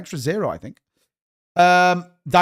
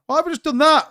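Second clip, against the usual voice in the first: exasperated outcry; 10 semitones higher than usual. Real, not acted. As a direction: yelp it out, annoyed at your own slip. Repeats his own slip aloud.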